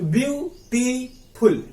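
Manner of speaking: three drawn-out beats in beautiful; syllable by syllable. Shown as a demonstration. Teacher counts off the word's parts, syllable by syllable. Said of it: "पहले बोले ब्यू".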